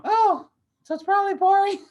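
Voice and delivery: a squeaky little girl mocking the film; mock daughter voice. Dad invents his daughter's jab at his co-host, squeaky high kid voice. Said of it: "No, she didn't say that".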